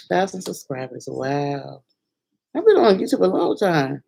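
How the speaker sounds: normal talking voice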